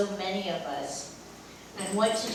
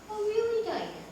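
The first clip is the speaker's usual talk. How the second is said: much higher, teasing "really"; mock surprise; 13 semitones higher than usual. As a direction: feign disbelief, playfully. Mock doubt at Diane's claim.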